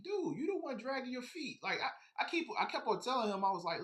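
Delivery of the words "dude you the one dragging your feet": high, incredulous retort; exasperated. Retold or imitated. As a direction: throw it back at him in disbelief.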